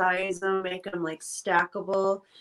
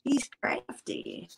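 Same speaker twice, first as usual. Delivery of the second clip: whisper; 2 semitones lower, 4 dB quieter, slower than usual. Real, not acted. Teasing whisper about partner.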